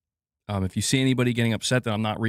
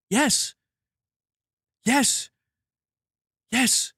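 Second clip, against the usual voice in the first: each yes pitched much higher, ringing out; emphatic yes; 11 semitones higher, much slower than usual. Real, not acted. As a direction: hammer the answer home with conviction. Emphatic answer in rant.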